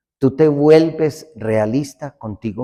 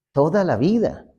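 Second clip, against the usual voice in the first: ordinary talk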